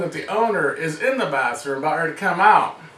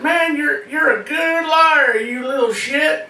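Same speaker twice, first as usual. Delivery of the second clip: a higher, slow yell of a mock-scolding father; dad's yell; 7 semitones higher, slower than usual. Dad yelling at his son about being a good liar. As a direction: bellow it like a dad half-proud of his lying kid. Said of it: "my dad said".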